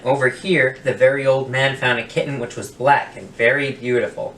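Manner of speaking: plain reading voice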